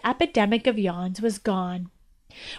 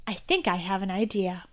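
quiet princess; 4 semitones lower, 11 dB quieter than usual. Acted.

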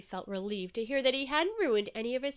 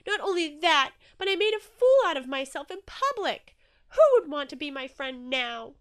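loud, higher-pitched despair; distraught lament; 6 semitones higher, 10 dB louder than usual. A narrator's reading. Inky ashamed, sure he has no friends, distraught lament. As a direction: wail it in self-reproach. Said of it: "began to weep salty tears".

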